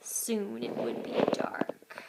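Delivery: slow hushed whisper; suspense whisper. A narrator's reading. Narrator building suspense in a whisper. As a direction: breathe it as a secret.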